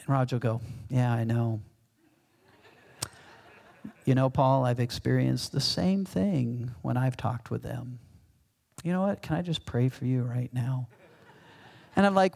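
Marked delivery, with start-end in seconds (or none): 0.9-10.9 calm, peaceful. Acted. Calm pastor offering prayer to his worked-up colleague, soothing. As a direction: imitate a calm colleague settling someone down. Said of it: "Roger just has this calm".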